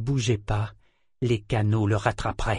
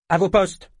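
sharp, loud, higher command; curt order; 7 semitones higher, 5 dB louder than usual. A narrator's reading. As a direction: snap the order at the crew. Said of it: "ordonna-t-il sèchement".

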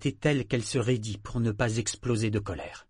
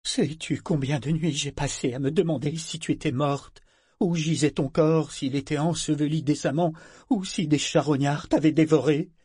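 higher, pained outpouring, a touch of trembling; 5 semitones higher, 5 dB louder than usual. A narrator's reading. Mother to the returned daughter, a mild trembling outburst. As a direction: let the fear of the lost nights spill over. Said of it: "Elle balbutia d'une voix tremblante".

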